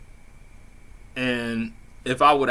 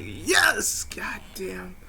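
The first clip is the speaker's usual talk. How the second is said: unvoiced, higher whisper of glee; whispered yes; 10 semitones higher, much slower than usual. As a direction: whisper it to yourself in triumph. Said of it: "I didn't say it like that, but I'm like".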